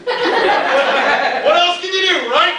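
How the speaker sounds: an amused shrug of a line, drawn out slow with laughter; laughing quote. Retold or imitated. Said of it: "And I go".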